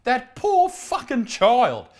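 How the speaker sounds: slow, high-pitched exclamation of pity; appalled pity. Real, not acted. Host reacting to a son in a homemade uniform, appalled pity.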